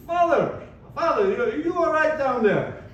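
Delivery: a hint of a drunk hollering down; mock drunk calling out. Drunk driver calling to priest.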